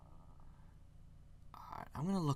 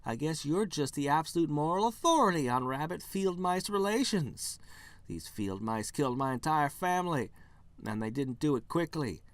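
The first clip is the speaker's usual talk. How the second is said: bitter rabbit; 5 semitones higher, 4 dB louder than usual. Acted. Bunny ranting at the human.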